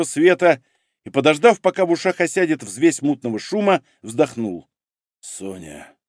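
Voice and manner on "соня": very soft, low murmur; whisper. A narrator's reading. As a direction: barely get it out, low and hushed. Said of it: "прошептал он". (слабый тихий шёпот)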